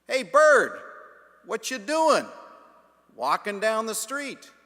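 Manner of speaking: loud, high call across the yard; preacher calls out loudly to a passing crow; shouting at the crow. Retold or imitated. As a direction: holler out the front door at a bird. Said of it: "he shouts out at the crow".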